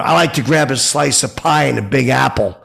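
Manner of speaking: said out loud. Retold or imitated. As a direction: pipe up so he hears it. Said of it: "so I said aloud".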